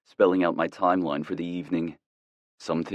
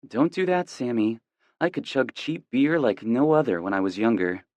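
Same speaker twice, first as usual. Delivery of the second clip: put-on girlfriend voice; 6 semitones higher than usual. A narrator's reading.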